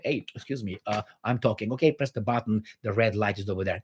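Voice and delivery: played-down mock phone-call courtesy; mock official talk. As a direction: imitate a politely restrained official, softly. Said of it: "that's the way they talk".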